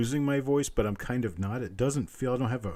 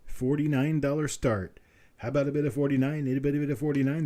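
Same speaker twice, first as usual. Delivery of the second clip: quick steady auctioneer patter; auction bid calling; 4 semitones higher than usual. Acted. Auctioneer calls for the next bid in brisk patter. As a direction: keep the bids rolling like an auctioneer.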